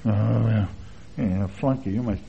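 imitated put-down, low; low, imitated scornful reply; mock dismissive aside. Acting out what askers imply.

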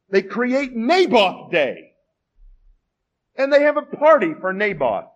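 loud, high, rising sermon cry; preaching at full force. Acted. Pastor pressing the congregation on Naboth, full-force preaching. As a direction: preach it hard, building the question.

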